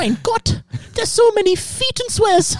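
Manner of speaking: put-on high little German boy accent with Mein Gott. Joke told to friends, high German child accent. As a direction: squeak it out like a scandalised German child. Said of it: "in a little German boy's accent".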